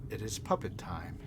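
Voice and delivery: quiet, low voice in the head; mysterious possessing voice. Acted. Unseen presence taking over a player's body, soft ominous voice. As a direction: softly voice an unseen puppeteer. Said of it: "and you hear a voice".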